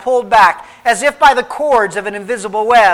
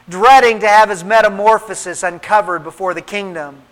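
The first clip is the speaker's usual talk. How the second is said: ordinary delivery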